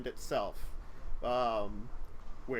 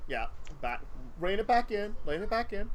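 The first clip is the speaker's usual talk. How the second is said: ordinary talk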